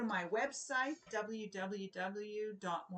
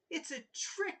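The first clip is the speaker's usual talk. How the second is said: warning; 7 semitones higher than usual. Acted. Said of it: "warned King Carl".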